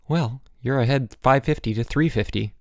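plain reading voice

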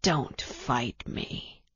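low, soft, slow threat; menacing villain. A narrator's reading. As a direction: lean in and press him. Villain whispers to dying hero.

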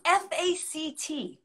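spelling out F A C T letter by letter, slowly; spelled out. Shown as a demonstration. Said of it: "I've never heard you talk like that to me".

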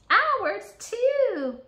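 very high, loud eager answer; both kids chime in at once; chorus reply. A narrator's reading. Jenny and Johnny answering together, high eager reply.

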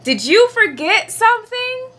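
high, lilting mother's voice; pointed, high-pitched question to a child. A narrator's reading. Mother asks Froggy, teasing.

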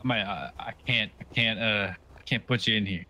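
usual speaking voice

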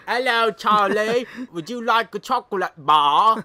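slow, loud, high mock-British; ugly British accent. Offering Charlie chocolate, hammed-up British accent. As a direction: draw out every word in bad British. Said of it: "It's so ugly".

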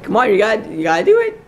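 usual speaking voice

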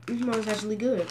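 talking with food in mouth; chewing while talking. Real, not acted. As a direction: mouth partly full of chips. Seen 0: Snack bag in hand; hand to mouth at +1.8, closed-mouth chewing.

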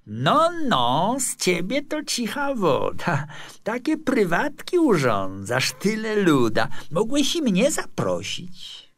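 put-on high, slow, teasing young man; youthful voice. A narrator's reading. Young neighbour teases his friend about a party, youthful banter. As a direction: drawl it high and loud, ribbing a pal. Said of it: "rzucił młodzieńczy głos".

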